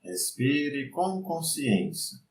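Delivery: slow, soft meditation voice; guided meditation. Acted. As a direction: lead the mind calmly, unhurried.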